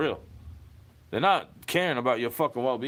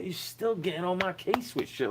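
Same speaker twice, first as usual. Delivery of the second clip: ordinary talk, nothing marked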